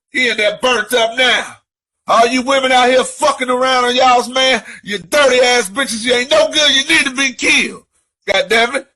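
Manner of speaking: screaming high and loud in a rage; unhinged furious rant, shouting at cheaters. Real, not acted. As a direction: yell it out, voice climbing out of control. Ranting after killing cheating girlfriend.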